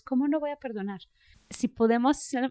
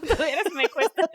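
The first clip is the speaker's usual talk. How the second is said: laughing through the words, a bit higher and louder; 8 semitones higher, 4 dB louder than usual. Real, not acted. Sharing with her co-host how hard forgiving is, laughing. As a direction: breaks into real laughter while admitting it.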